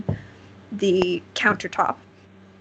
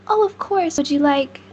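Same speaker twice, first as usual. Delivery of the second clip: high-pitched helpful shopkeeper voice; obliging shop owner; 6 semitones higher than usual. Acted. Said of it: "who you assume is the owner says".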